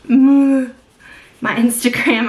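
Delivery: amused, self-teasing drawl; laughing, drawn out. Real, not acted. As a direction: stretch it out through a laugh. Repeats the phrase, laughing.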